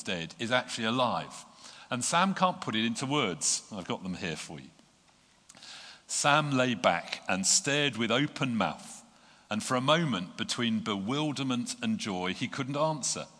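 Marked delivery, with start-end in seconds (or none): 6.1-13.3 building storybook narration; story reading. A narrator's reading. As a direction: read the passage like a storyteller. Reading Tolkien aloud.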